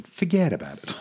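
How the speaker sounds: high, hammed-up mobster Italian; Italian mafia accent. Co-host jokes the translation reads like a mafioso. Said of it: "The New Living Translation".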